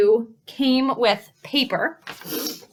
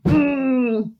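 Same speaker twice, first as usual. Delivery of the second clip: upset grunt; 3 semitones higher than usual. Shown as a demonstration. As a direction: grumble a pent-up mm. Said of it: "when she's upset".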